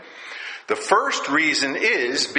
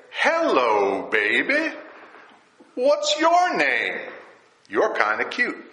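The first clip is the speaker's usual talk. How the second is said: playful flirty pickup line, rising much higher on the question; mock pickup line; 7 semitones higher than usual. Preacher mocking what Adam did not say to Eve, in a hammed-up flirty pickup line. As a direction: ham up a cheesy come-on to a pretty stranger. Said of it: "He doesn't say that".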